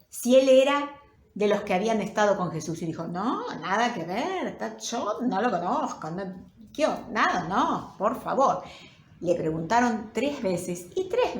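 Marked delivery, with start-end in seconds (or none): 3.1-8.6 hasty, wavering protest of innocence; flustered denial. Acted. Peter denying to a servant that he knows Jesus, flustered. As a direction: brush off the accusation, stumbling over the words. Pedro niega conocer a Jesús.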